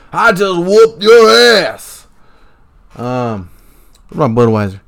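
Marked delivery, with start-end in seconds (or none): 0.1-2.1 slow, loud, high wrestler taunt; Stone Cold Steve Austin. Retold or imitated. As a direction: snarl it like a pro wrestler gloating over a beaten rival. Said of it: "Austin three sixteen says".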